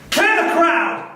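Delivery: shouted order. Retold or imitated. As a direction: bellow the order across the field. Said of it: "That was the magistrate's order".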